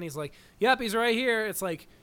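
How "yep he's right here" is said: louder, higher shouted reply; imitated yelling, raised but not full; retold yell back. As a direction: call back up to them. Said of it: "they're yelling to him upstairs".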